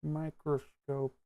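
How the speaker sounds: slow, soft muttered wordplay; quiet playful mumble. Pretend. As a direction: drawl it to yourself, slowly. Playful word-play mumble to himself.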